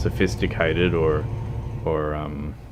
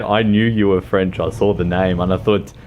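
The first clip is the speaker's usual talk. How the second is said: ordinary delivery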